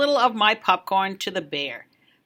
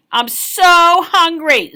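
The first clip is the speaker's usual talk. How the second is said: put-on slow, higher whining child; hungry whine; 7 semitones higher, 8 dB louder, slower than usual. A child whining at story time, slow.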